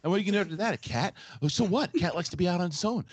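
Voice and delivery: high, fast, fretful whine of outrage; an overwrought worrier piling on objections; personified anxiety. Pretend. Mocking a cohost for voice impressions, as anxious whiner. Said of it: "you just personified anxiety in a voice".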